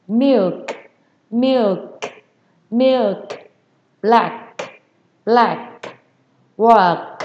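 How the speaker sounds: each word ending in a separate K burst; throaty released final K. Shown as a demonstration. Teacher drilling the throat sound, hawked final K. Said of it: "Âm này là mình khạc ra".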